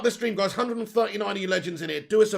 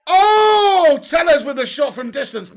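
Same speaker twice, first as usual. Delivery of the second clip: loud, high burst of excitement; excited shout; 8 semitones higher, 13 dB louder, slower than usual. Real, not acted. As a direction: shout as the shot flies. Shot from distance, live reaction.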